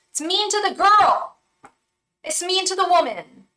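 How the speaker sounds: high, loud campy outburst; Katya impression. Quoting Katya to the viewer, high and indignant. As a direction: channel Katya with a big indignant wail. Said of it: "that was Katya's".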